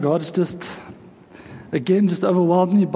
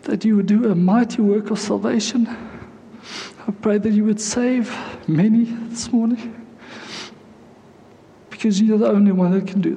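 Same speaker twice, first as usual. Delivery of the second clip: emotional prayer; 3 semitones higher than usual. Real, not acted. Preacher praying to God for many to be saved, overcome with emotion. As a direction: plead through welling emotion, voice pitched up. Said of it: "I'm so emotional this morning".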